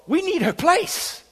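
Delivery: high, drawn-out self-centred grumble; selfish inner thought. Retold or imitated. Preacher confesses his thought about the lone woman, selfishly. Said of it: "what a horrible person I am".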